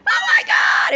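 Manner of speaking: very high, loud delighted cry; wife bursting out in a high delighted shriek; excited shout. Retold or imitated. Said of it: "his wife was going".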